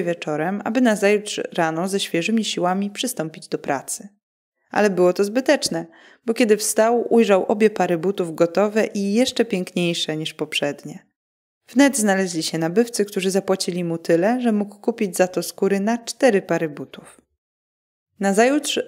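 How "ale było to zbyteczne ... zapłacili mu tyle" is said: usual speaking voice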